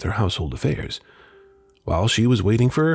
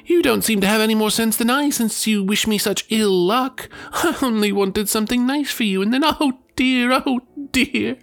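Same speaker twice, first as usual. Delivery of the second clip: much higher, weepy reproach; tearful pleading; 11 semitones higher than usual. Acted. Wife reproaching her husband through tears, weepy. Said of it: "went on crying and sobbing".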